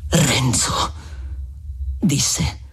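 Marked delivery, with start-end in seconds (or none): 0.1-0.9 low, grave address; calmer seriousness. A narrator's reading. Friar addressing Renzo after the outburst, calmly serious. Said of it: "con una serietà più tranquilla".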